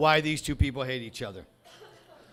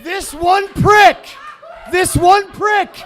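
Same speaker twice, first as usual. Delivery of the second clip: yelling at a troll, much higher and louder; furious shout; 10 semitones higher, 13 dB louder, slower than usual. Real, not acted. Comic screams at online commenter, furious shout. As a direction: roar it out at the commenter.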